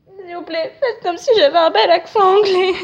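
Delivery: high, whiny fake-crying plea, growing loud; mock tearful pleading. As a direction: beg them in a hammed-up wail.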